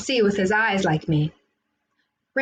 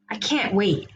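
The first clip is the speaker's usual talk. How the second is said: ordinary delivery, nothing marked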